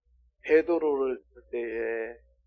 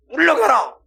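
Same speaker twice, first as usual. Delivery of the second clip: loud, high shouted command; stern rebuke; 9 semitones higher, 11 dB louder than usual. Acted. Preacher acts out Jesus rebuking the obstacle, stern rebuke. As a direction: drive it away with a shout. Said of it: "얘를 꾸짖는 거야".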